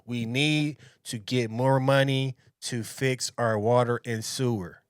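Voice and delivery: slow, stiff mock script-reading; scripted mayor, no personality. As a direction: imitate a lifeless politician reading a script. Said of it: "he's reading off of a script. He has no personality".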